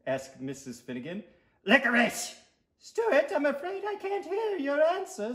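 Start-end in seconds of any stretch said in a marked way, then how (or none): 1.7-2.4 loud, higher outburst; blurted shout. Acted. Tooth blurts in class. 2.8-5.3 very high-pitched prim lady teacher; schoolteacher voice. Acted. Teacher addresses pupil.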